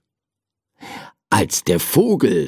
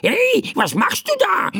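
very high, squawked protest; bird calling out. A narrator's reading. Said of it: "rief der Vogel". (aufgebrachter, kreischender Vogel)

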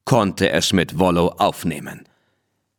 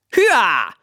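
shouted giddy-up; 10 semitones higher than usual. A narrator's reading. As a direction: yell to spur the horse on. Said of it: "schrie er".

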